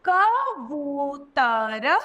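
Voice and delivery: कबूतर broken into slow syllables, rising at the end. Shown as a demonstration. Teacher sounding out कबूतर for pupils, very slow. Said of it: "तो कैसे कहेंगे?".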